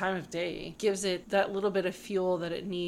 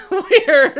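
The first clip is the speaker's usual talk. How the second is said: laughing through the words, louder; 11 semitones higher, 15 dB louder than usual. Real, not acted. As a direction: giggle out the confession. Embarrassed confession.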